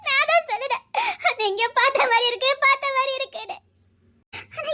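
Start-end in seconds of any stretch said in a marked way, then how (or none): none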